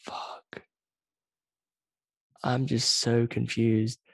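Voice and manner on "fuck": slow, whispered disbelief; stunned whisper. Retold or imitated. Retold stunned reaction to cheating.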